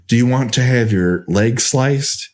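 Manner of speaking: ordinary talk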